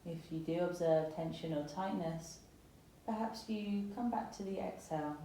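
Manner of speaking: calm, unhurried yoga meditation voice with long pauses; guided meditation. Acted. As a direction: gently guide them into settling and softening, phrase by phrase. Guides breathing and body scan.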